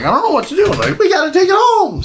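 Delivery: high, panicky mock teen voice. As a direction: squeal it like a frightened teen. Mock panicked teens in retold film.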